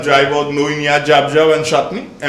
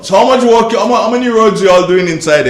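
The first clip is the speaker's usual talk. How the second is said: loud; 9 semitones higher, 6 dB louder than usual. Real, not acted. Host calling out to caller.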